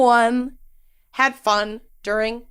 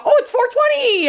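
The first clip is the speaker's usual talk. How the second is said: much higher excited burst; suddenly thrilled, up high; excited exclamation. Real, not acted.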